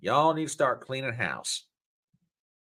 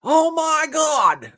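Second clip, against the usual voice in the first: much higher, louder, drawn out slowly; amazed exclamation; 8 dB louder, much slower than usual. Real, not acted. Telling viewers about New York news, amazed and drawn out. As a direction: stretch it in sheer delight. Excited about NYC story.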